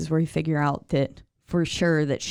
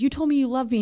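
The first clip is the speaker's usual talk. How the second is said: pleading Edith; 8 semitones higher, faster than usual. Retold or imitated. Edith confronting Thomas, acted quote.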